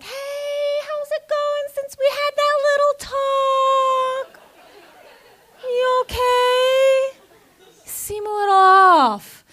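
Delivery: very high, slow, sing-song concern; syrupy high manager. Retold or imitated. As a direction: coo at him like a worried boss. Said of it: "she's like".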